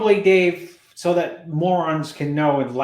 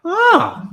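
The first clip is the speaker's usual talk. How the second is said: high strained grunt imitating Bob; sudden high yelp through a pen; Bob's grunt; 6 dB louder than usual. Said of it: "He's making noises".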